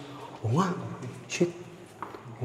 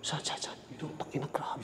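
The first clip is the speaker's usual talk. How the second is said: hushed scared whisper; frightened whisper about a voice; 18 dB quieter than usual. Real, not acted. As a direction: whisper it so nobody hears. Hushed after voice heard.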